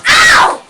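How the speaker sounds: sharp high shouted ow; real yelp of pain. Real, not acted. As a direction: yelp as the teeth sink in. Dog bites during no-biting lesson.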